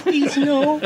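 high-pitched mock plea; pleading movie character. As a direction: squeak it out like a helpless stoner. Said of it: "poor guy number three says".

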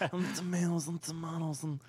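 mumbled movie godfather voice; Marlon Brando. Acted. Podcaster taking a turn at impressions, Brando godfather voice. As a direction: put on an ageing mob boss's voice. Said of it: "do Marlon Brando impressions".

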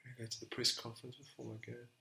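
very soft, quick recap; quiet thinking aloud; pondering to himself. Real, not acted. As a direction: repeat it under your breath as you puzzle. Guesser repeats quote to himself.

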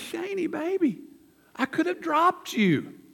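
breathless relief after the catch, softly; startled grandma. Retold or imitated. Grandma to grandson she just caught, lightly voiced relief.

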